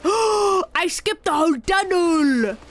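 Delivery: loud slow triumphant gasp; thrilled disbelief at the trick; excited. Real, not acted.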